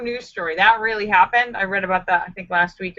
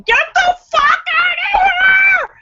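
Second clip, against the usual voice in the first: full, very high reenacted scream; high-pitched drawn-out yell; 5 dB louder than usual. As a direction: scream it to drive off an intruder. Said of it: "I just started screaming".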